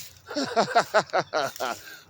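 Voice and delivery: a burst of high, loud laughter; real, loud happy laugh. Real, not acted. Laughs at himself.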